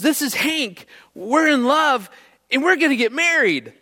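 high-pitched kindergartner announcing big news; excited little girl. Retold or imitated. Daughter announces her engagement to dad, excited little-girl voice. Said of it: "before Taylor yells out".

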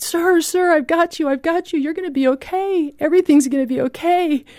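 high-pitched urgent call of reassurance, not at full volume; retold shout. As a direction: reenact calling out to a drowning man. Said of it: "and I just shouted".

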